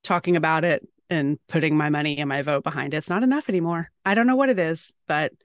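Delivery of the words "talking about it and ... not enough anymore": usual speaking voice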